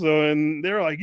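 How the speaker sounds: usual speaking voice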